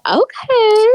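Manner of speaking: high, drawn-out okay sliding upward; intrigued okay. Retold or imitated. Cohost recalling being into the scene, intrigued. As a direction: stretch it upward, pleasantly taken aback.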